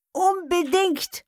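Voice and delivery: high, slow, emphatic "Unbedingt!"; eager insistence. Retold or imitated. Husband insists on a parrot.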